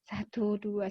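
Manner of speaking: soft, low inward count; counting inwardly. Shown as a demonstration. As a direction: count to yourself to cool down. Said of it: "Hitung dulu satu sampai sepuluh dalam hati ya". (menghitung pelan dalam hati)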